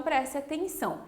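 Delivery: usual speaking voice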